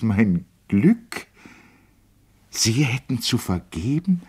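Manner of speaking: Lotte's voice. A narrator's reading. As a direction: speak up brightly as an old lady teasing him.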